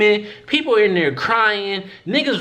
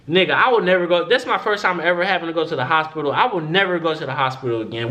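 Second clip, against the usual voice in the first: quick, fed-up rush of words; fast indignant vow; faster than usual. Real, not acted. Swearing off the hospital after the wait, fast and fed up. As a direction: rattle it off, fed up for good.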